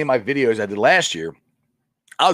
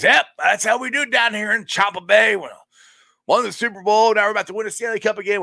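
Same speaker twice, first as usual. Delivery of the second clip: mock smug bragging, pitched a bit higher; gloating brother-in-law; 9 semitones higher than usual. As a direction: imitate a brother-in-law rubbing it in. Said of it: "Rubbed it in my face".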